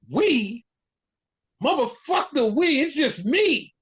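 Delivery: high-pitched mock indignation; indignant retort. Wrestler cuts down his partner's "we", indignant. Acting out Roman angrily rejecting "we.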